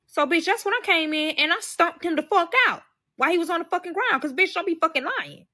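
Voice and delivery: high, loud, indignant retelling; heated, high-pitched fury in the retelling; worked-up outrage. Retold or imitated. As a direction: tell it fired up, pitch shooting way up. Retelling how she attacked the liar.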